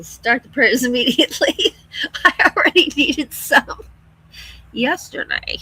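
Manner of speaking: amused, laugh breaking into the words; laughing plea. Real, not acted. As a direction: joke through real laughter. Laughs about needing prayers.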